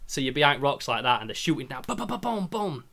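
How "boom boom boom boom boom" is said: high-pitched rapid booms; imitated gunfire. Player retelling a shootout behind rocks, high imitated gunfire. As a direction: fire off mock gun booms.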